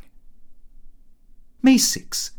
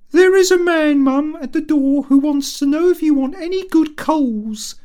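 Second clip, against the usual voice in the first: put-on much higher, louder servant girl's voice; grinning maid; 8 dB louder than usual. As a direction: pipe up brightly as a grinning maid. Grinning maid interrupts the dance.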